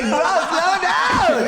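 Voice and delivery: high, slow laughing mock yell. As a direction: stretch it out, yelling through a laugh. Retold begging friend to slow.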